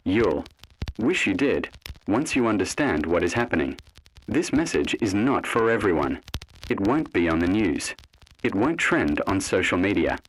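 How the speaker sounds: ordinary delivery, nothing marked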